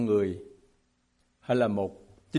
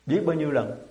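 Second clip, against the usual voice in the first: ordinary talk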